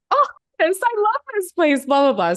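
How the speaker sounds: high-pitched mock rapture; gushing delight. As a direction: gush dreamily, then let it drop away. Acting out gushing delight in class.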